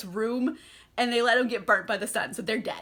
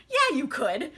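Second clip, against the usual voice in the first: higher, incredulous retort; mock calling-out; 8 semitones higher than usual. Louis calling out Armand's excuse, mock indignant. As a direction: challenge the excuse, rising in pitch. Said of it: "he calls him out on his shit".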